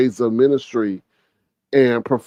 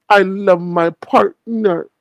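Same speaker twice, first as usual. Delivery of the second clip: slow, higher mock little-kid voice; little baby voice; 5 semitones higher, slower than usual. Host mocking how he loves his partner, like a little kid. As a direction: imitate a baby, drawing out each word. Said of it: "like a little baby, like a little kid".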